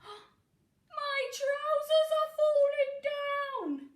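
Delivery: loud, high, slow dismay; a giant panicking loudly; alarmed cry. Acted. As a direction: wail it as the trousers fall. Giant's trousers falling, alarm.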